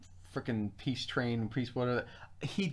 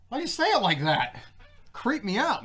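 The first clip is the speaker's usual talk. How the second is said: creeped out; 7 semitones higher, 3 dB louder than usual. Retold or imitated. Acts out creeped-out reaction to neighbor.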